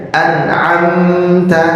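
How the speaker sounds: "an'amta" drawn out with the m hummed through the nose; wrong reading. Shown as a demonstration. Teacher chanting the forbidden nasal reading. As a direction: sing "an'amta" slowly, humming the m. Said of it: "Jadi nggak boleh dibacanya".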